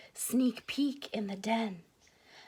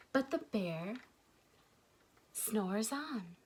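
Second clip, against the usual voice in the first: softer, hushed refrain; 2 semitones lower, 7 dB quieter than usual. A narrator's reading. Narrator hushing for the sleeping bear, softly. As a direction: drop to a hush so the bear sleeps.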